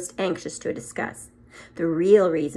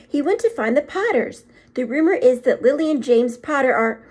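urgent witch; 7 semitones higher, 6 dB louder than usual. Acted. Professor presses Dumbledore for the truth, higher and urgent. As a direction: press him, dreading the news.

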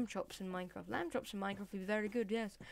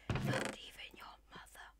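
softly whispered jab; taunting whisper; 5 dB quieter than usual. Pretend. Player insulting Mister Sheep, whispering.

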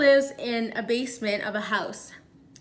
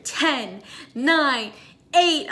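panicked countdown; 3 semitones higher, 4 dB louder, much slower than usual. Retold or imitated. Alarm counting down, she counts along in rising panic.

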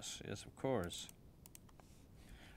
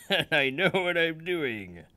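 laughing through it, higher and louder; 7 semitones higher, 6 dB louder than usual. Real, not acted. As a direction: crack up while insisting you know. Repeats claim, laughing at himself.

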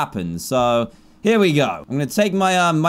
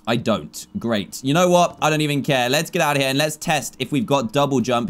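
usual speaking voice

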